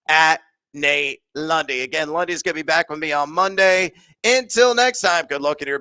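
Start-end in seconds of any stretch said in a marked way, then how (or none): none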